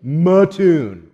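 MATTOON as muh-TOON, loud, stress on the end; outsiders' pronunciation. Shown as a demonstration. Showing how outsiders say it, Mattoon as muh-TOON. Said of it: "how do you know they're not from around here".

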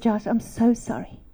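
low, soft retold sorry; contrite apology. Mom apologising to her son Josh, softly contrite. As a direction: apologise gently, voice dropped.

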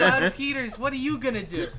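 put-on loud, high kid's challenge; kid game master. Kid game master asking John Peters to act, high call.

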